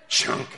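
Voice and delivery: abrupt, loud blade chop; sudden loud chop noise; imitated beheading thud. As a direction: make the axe landing. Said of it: "and you hear chunk".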